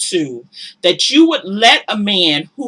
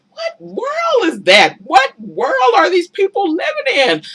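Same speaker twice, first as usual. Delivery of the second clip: much higher, slowed outraged disbelief; incredulous; 9 semitones higher than usual. Real, not acted. To viewers, incredulous at these people's lives. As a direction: demand it of the world.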